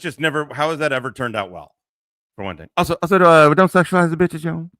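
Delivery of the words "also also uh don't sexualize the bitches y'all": mock rule.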